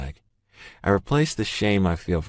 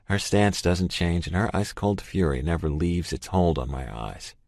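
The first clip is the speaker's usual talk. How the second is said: ordinary delivery, nothing marked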